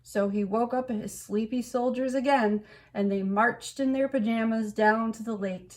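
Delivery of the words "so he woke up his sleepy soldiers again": ordinary delivery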